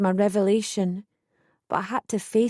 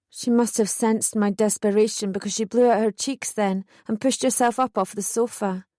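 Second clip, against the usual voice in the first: plain reading voice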